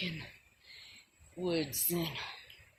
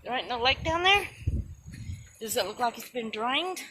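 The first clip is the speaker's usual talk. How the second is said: surprised calling out; 3 semitones higher, 6 dB louder than usual. Real, not acted. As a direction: call out in surprise.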